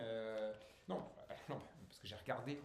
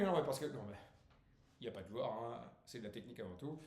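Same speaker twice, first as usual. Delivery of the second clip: normal talking voice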